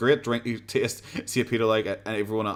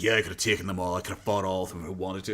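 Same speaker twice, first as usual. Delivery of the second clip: mock tough brag; 6 semitones lower, 5 dB quieter, faster than usual. Mocking toy show guy brag.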